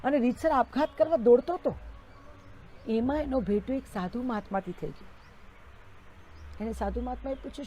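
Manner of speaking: usual speaking voice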